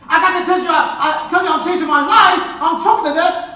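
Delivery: loud, high, desperate husband; husband crying out to his wife; anguished stage cry. Acted. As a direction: burst out with it. Onstage husband's outburst.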